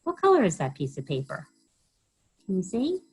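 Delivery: usual speaking voice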